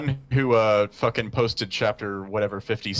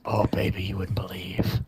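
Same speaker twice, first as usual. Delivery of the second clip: whispering. Pretend. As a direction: lean in and whisper it. Teasing aside about the father.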